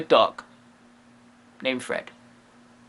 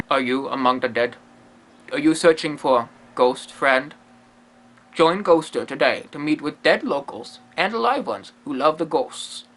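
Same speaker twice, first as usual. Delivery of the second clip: lower, mock ad voice; mock promo read; 5 semitones lower than usual. Shown as a demonstration. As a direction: sell it like a radio spot. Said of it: "Let me try my promo skills".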